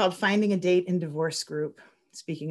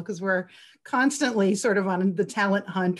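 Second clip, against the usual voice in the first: normal talking voice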